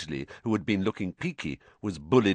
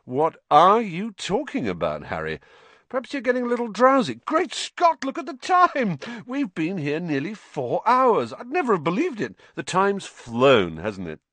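much higher, fast breezy chatter; a vain wizard oblivious, gushing about the time; Lockhart's voice; 10 semitones higher, 4 dB louder than usual. Acted.

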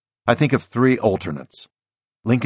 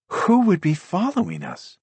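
higher, worried wife voice; woman's voice; 11 semitones higher than usual. A narrator's reading. Wife's frightened question in story.

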